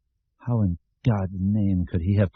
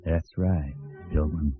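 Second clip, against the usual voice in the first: lower, slow Southern drawl with a bald twang; drawling Southern accent; 6 semitones lower, 6 dB quieter, slower than usual. A narrator's reading. A dream figure taunting Mort in a lower Southern drawl. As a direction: drawl it out like a Deep South country boy. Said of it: "in his bald, drawling Southern accent".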